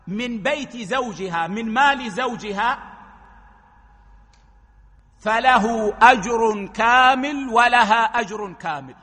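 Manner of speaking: normal talking voice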